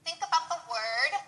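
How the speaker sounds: ordinary talk, nothing marked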